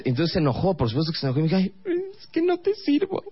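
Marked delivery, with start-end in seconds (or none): 1.6-3.2 put-on very high, whiny offended girlfriend voice; hurt girlfriend. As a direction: whine it like a sulking girlfriend.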